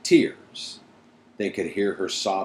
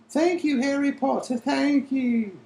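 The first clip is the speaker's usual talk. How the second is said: much higher, breathless elf squeak; squeaky elf voice. Acted. Dobby thanking Harry Potter in a squeaky elf voice. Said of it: "said Dobby, breathlessly rubbing his head".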